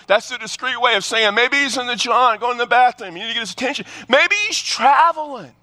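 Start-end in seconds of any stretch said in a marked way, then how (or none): 1.3-5.5 mocking trash talk. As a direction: rub it in, teasing the rivals. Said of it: "He's egging him on".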